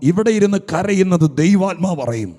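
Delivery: loud, high, rising preaching shout; preaching at full force. Acted. Preacher shouting the promise to the weeping congregation. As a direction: thunder it out to the whole church.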